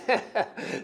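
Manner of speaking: short, airy laugh; quick amused laugh; real chuckle. Real, not acted. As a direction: chuckle briefly at your own remark. Laughs at his own joke.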